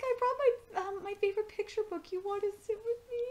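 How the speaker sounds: put-on very high, fast small-kid voice; high, quick cutesy child; little child voice. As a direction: play the shy toddler asking to sit. Voicing the little kid's line.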